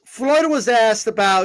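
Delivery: usual speaking voice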